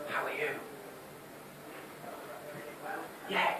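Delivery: very soft, hushed reply; barely audible small talk; whispered exchange. Shown as a demonstration. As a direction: whisper it back to match. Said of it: "if someone whispers at you".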